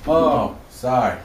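usual speaking voice

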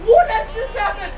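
can't believe what just happened; high, breathless what just happened; stunned disbelief. Real, not acted. Stunned after wild finish.

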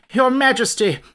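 desperate frightened call; rising panic. Acted. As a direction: panic building as he repeats it. Soldier bursts in fleeing in panic.

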